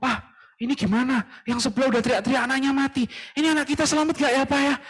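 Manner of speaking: high, fast anxious pleading to her husband; wife anxiously asking her husband; worried wife. Acted. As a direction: rush the worry out to him. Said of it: "istrinya ngomong".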